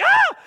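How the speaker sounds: very high, loud short yell; imitated alarm scream; panicked scream. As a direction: yell in sudden panic before a crash. About to hit his mom.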